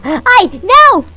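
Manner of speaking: high, loud, slow fright; startled cry. Real, not acted. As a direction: cry out as if you almost fell. Nearly falls into lava. (susto, grito agudo e lento)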